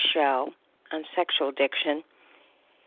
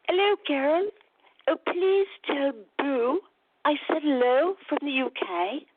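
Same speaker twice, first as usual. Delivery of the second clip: imitating a client; 7 semitones higher than usual. A client calling from the UK greets Carol, in a higher imitating voice. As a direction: play the eager caller from abroad, pitched up.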